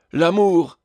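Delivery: high, loud exclamation on L'amour; struck, declaimed outburst; theatrical cry. A narrator's reading. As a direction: cry out like a stage tragedian. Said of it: "s'écria le tragédien".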